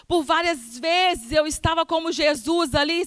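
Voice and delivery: ordinary talk, nothing marked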